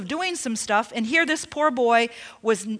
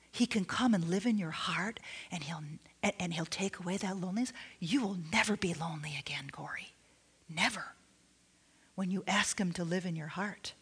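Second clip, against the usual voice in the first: much softer, hushed reassurance, sliding into a whisper; softly comforting; 5 semitones lower, 13 dB quieter than usual. Retold or imitated. Pastor comforting lonely little Corey, soft hushed whisper. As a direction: soothe a lonely child, barely above a whisper. Comforting crying boy at mat.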